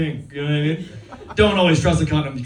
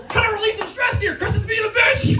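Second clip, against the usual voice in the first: high mock whine. Real, not acted. Jokey high rant.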